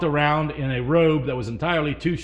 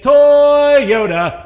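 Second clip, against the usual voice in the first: "Toyota" belted high and loud; imitating the ad man; 10 semitones higher, 10 dB louder than usual. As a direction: shout it like the jumping ad man. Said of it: "he said".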